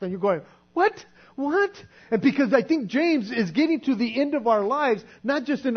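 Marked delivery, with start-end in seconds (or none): 0.7-1.7 startled what. Retold or imitated. Shocked reaction at the judgment seat.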